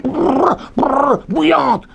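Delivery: high, drawn-out brrr then a slow "bouillantes"; mock shiver. Rabbi teaching, acts out boiling water with a playful shiver. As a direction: act out the scalding water.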